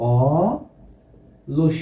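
অলস very slowly as a held অ, a pause, then লস; split into syllables. Shown as a demonstration. Teacher shows students the two syllables, অলস broken as অ... লস. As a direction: stretch the অ of অলস, then add লস separately. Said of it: "দুইটা অক্ষরে আমি ভেঙে দিলাম".

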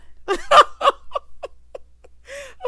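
very high, loud cackling laugh; real laugh. Real, not acted. Cracks up after the name correction.

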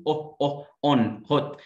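British "on, hot" with lips rounded on short o; British rounded vowel. Shown as a demonstration. Teacher demonstrating lip rounding, British accent. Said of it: "في البريتش بضم بقي".

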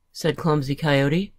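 plain reading voice

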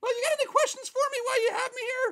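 high, quick diva voice. Acted. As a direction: hurry the interviewer along in a high star voice.

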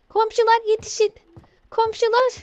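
yüksek perdeden şakacı yardım çağrısı; komşulara tiz, yarı şaka seslen. Pretend. Yardım için bağırıp şakalaşıyor. (komşulara seslenen oyuncu, şakacı yardım çağrısı)

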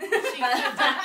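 loud burst through laughter; laughing. Real, not acted. Teasing a colleague about an unrelated video, laughing.

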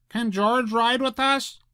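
put-on high, eager child's voice; excited little boy. A narrator's reading. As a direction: beg eagerly to take him along. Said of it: "The little boy was excited".

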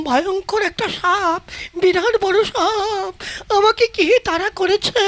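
slow, drawn-out words about the snake; slow dramatic telling. Acted. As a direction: stretch each word to show how big. Describing the huge snake.

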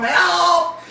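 very high, loud comic scream for help; mock cry for help. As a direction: squeal it like a trapped damsel. Sarah crying out from harem.

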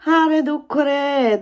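loud, high, drawn-out mock wail; hammed-up woe, called out slowly; playful lament. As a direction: stretch it out like a put-upon lament. Mock lament over her woes.

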